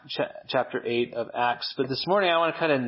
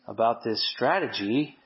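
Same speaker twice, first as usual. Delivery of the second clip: ordinary talk, nothing marked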